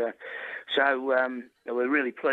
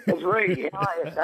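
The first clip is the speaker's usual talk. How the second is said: laughing; 6 semitones higher than usual. Real, not acted. Prank caller reveals himself.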